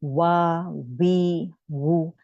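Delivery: वा वी वू with lips rounded; rounded-lip waw. Shown as a demonstration. Teacher showing the class rounded waw sounds. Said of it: "वाव में आपके होंठ गोल रहेंगे".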